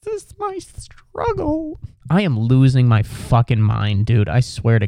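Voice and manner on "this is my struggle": very high, soft and slow; drawn-out, quiet mock-suffering squeal; strained squeak. Shown as a demonstration. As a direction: squeeze it out in a tiny high voice. Repeats his struggle in strained voice.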